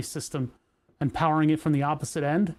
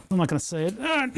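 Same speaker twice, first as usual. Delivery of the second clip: ordinary talk, nothing marked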